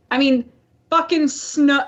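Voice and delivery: usual speaking voice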